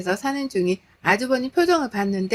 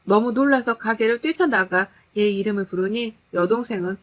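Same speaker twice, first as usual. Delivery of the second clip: ordinary delivery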